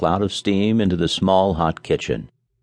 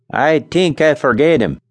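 put-on Scandinavian accent; 7 semitones higher, 4 dB louder than usual. A narrator's reading. Nels voiced, accent.

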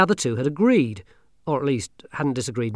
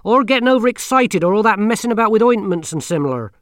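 put-on stern old witch; 10 semitones higher, 10 dB louder than usual. Granny Weatherwax voiced.

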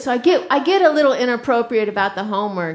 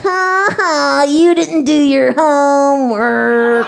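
slow, loud, laughing mock taunt; taunting kid; 6 dB louder, much slower than usual. Son shouting out the window at workers, slow loud taunt. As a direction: drag out the gloat like a bratty kid.

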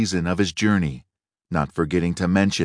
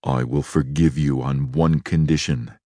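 low beast voice, calmer but firm; a hint of the beast's menace, softened to bargaining; less furious beast; 5 semitones lower than usual. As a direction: set your terms evenly, the rage held back. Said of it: "in a less furious tone".

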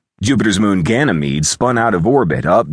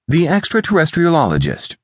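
high, feminine character voice; sweetly pitched-up explanation; caressing woman's voice; 12 semitones higher than usual. A narrator's reading. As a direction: say it light as a summer breeze. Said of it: "her voice like the caress".

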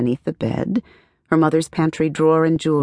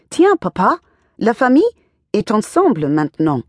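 high, excited, choppy French; bursting with French for papa; choppy musical French; 7 semitones higher, 5 dB louder than usual. Acted. Girl leaping into her father's arms, high excited French. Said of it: "choppy, yet musical tone".

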